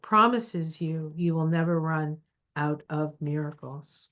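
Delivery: normal talking voice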